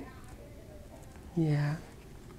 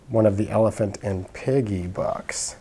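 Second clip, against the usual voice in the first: ordinary delivery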